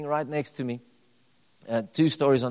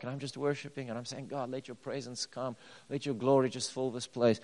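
soft, murmured worship, much quieter; hushed prayer; 8 dB quieter than usual. Retold or imitated. Pastor retelling his quiet prayer in a park, hushed. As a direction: pray under your breath as if alone with God.

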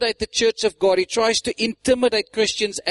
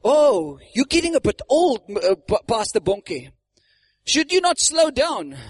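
high-pitched, mock-solicitous question; concerned questioner; 4 semitones higher than usual. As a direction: lift it high, gently doubting. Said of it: "they asked him".

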